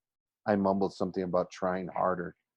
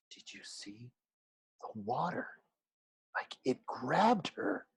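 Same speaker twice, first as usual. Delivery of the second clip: hushed, breathy whisper, much softer; 4 semitones higher, 7 dB quieter than usual. Acted. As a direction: whisper it so the teacher won't hear. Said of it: "Some of the kids were whispering".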